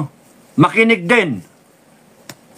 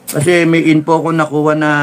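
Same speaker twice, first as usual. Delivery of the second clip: ordinary talk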